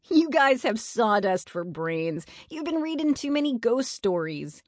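high-pitched, lightly laughing taunt; mock them as if cracking up; amused mockery. A narrator's reading. Eddie teases friends over ghosts, mildly laughing mockery. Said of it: "Eddie fell on the ground laughing".

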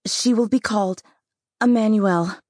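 high, loud solemn pronouncement; solemn declaration. Acted. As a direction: announce the name with force. Midwife declares the name.

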